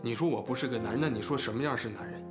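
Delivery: low, heavy, hurt voice; subdued, low-pitched reproach; wounded appeal. Acted. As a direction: drop low and question her, deeply hurt. Wounded appeal to older sister.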